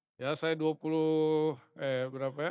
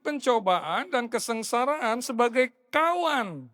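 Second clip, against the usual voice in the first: higher and louder, proclaimed; full-force preaching; 7 semitones higher, 5 dB louder than usual. Acted. Pastor proclaims the definition of patience, louder and higher. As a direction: declare it to the whole church.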